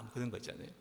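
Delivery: ordinary talk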